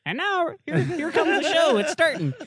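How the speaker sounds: high Irish leprechaun voice; laughing leprechaun-style Irish lilt. Pretend. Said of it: "It would sound like a Irish leprechaun saying".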